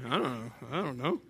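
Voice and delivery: low, soft, unsure mumble; mock cluelessness. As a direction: imitate people shrugging off a question. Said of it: "Y'all don't even know anymore".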